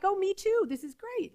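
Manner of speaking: high, upbeat agreeing voice; eager yoga teacher. Retold or imitated. Yoga teacher to grieving student, eager high-voiced agreement. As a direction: chime in eagerly, pitched well up. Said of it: "She's like".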